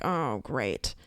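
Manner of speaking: low, deadpan sarcastic aside; dry sarcasm. Retold or imitated. Hearing the agent has a situation, dry low sarcasm. Retold sarcastic reaction to bad news.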